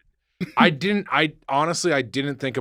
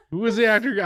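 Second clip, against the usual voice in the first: laughing; 10 semitones higher, faster than usual. Real, not acted. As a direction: still giggling, asks again. Repeats question, still laughing.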